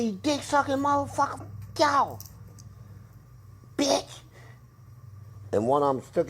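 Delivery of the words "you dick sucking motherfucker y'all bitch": high-pitched furious cussing; angry cussing. Retold or imitated. Inmate yelling at rats in his cell, high angry cussing. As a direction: let the rats have it, pitched way up. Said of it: "I said".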